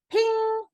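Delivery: high bright ping sound; a sharp high ping like a notification. A narrator's reading. Sound effect in her head.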